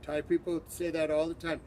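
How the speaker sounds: normal talking voice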